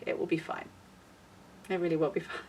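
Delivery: soft, barely voiced reassurance; quiet self-reassurance. Vlogger reassuring herself after a bad week, very quietly. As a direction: trail off softly, convincing yourself.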